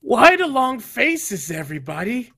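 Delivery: grin through it, drawing out each repeat; singsong stage host, repeats gradually winding down; smiling showman. Acted. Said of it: "he smiles, he says".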